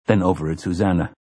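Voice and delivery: ordinary delivery, nothing marked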